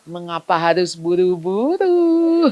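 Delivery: slow, deliberately relaxed father; unhurried calm. Retold or imitated. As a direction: drawl it to annoy the kid. Father calmly refusing to hurry.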